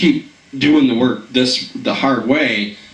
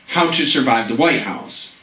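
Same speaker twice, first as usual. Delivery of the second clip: ordinary talk, nothing marked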